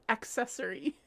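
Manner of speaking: accessory said "ak-sessory"; correct pronunciation. Shown as a demonstration. Streamer spelling out A C C, pronounced with the k. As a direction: put the k sound in accessory. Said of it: "A C C".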